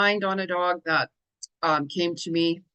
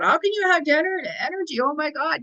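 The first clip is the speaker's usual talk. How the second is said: high-pitched incredulous quote; mock disbelief; 8 semitones higher than usual. Others asking her about all that energy, acted astonishment. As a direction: imitate an amazed onlooker, pitch well up.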